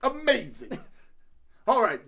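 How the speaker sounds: ordinary talk, nothing marked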